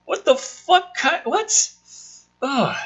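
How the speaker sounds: high, incredulous outburst; baffled. Real, not acted. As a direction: pitch up in disbelief at the nonsense. Reacting to an absurd script line.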